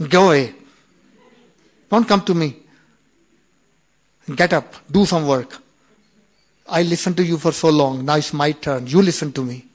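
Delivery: loud, stern orders to the headache; merciless command. Retold or imitated. Dadi Janki ordering the headache off, loudly. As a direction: boss the headache away, no mercy. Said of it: "She can be so merciless".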